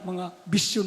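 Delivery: usual speaking voice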